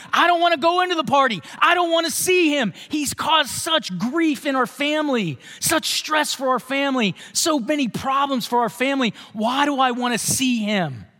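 high, indignant refusal to go to the party; the resentful brother venting; sulky angry son. Retold or imitated. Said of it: "What's he going to say?".

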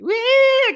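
high squealed wee; gleeful squeal. Retold or imitated. Recapping Cartman playing with the corpse, high squeal. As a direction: squeal like a delighted kid. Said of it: "He's like".

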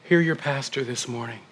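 ordinary talk, nothing marked

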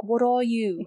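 creature mimicking a British accent; imitated British accent, echoing her words. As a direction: put on a rough British accent. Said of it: "it imitates a British accent".

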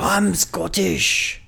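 put-on very slow, high Scottish accent; George Reed Scottish voice. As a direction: stretch it out in a broad Scottish accent. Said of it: "probably the best one you've done".

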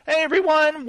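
high greeting that cracks; voice cracking. Real, not acted. Host welcoming listeners to the show, voice cracking. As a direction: let the voice slip upward as it greets. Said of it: "my voice kinda cracked there".

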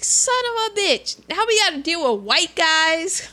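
high, exasperated cop curse; lightly voiced annoyed detective; fed-up cop.

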